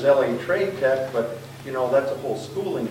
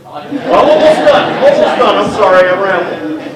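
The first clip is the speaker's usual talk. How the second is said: laughing apology; 8 semitones higher, 13 dB louder than usual. Real, not acted.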